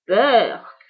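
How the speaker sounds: loud "beurre" of disgust; boy's grossed-out yuck; disgusted grimace. Acted. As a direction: gag at the smell. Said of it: "fit Pierre en grimaçant".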